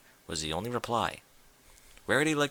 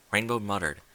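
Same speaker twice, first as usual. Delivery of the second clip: ordinary delivery, nothing marked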